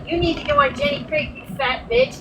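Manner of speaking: high-pitched mimicked insult; mocking imitation. Mimicking the other girl's insult.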